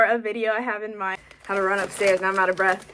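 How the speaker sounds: ordinary delivery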